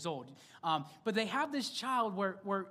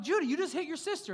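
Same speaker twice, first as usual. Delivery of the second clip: scolding dad; 8 semitones higher, faster than usual. Retold or imitated. Parent scolding son.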